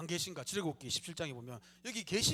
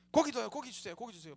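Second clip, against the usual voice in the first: whiny begging; 5 semitones higher, 4 dB louder than usual. Retold or imitated. Israelites begging for meat.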